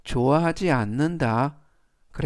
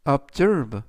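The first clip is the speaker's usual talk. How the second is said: "observe" as ob-ZERVE, second syllable stressed. Shown as a demonstration. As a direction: punch the -SERVE of "observe". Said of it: "S 뒤에 있는 E의 강세가".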